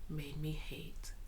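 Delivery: hushed, grave whisper; dramatic whisper. Real, not acted. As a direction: whisper it like a dark confession. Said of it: "one grievance that I take very seriously".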